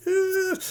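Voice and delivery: high, loud held squeal; sudden high-pitched wail of alarm; imitated alarmed noise. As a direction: squeal as if watching a near-disaster. Imitates noise at camera jostle.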